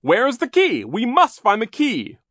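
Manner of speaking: loud, high, frantic demand; alarmed cry. A narrator's reading. Banker demanding the key from the children, frantic cry. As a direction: cry out urgently for help. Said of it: "he cried to the children".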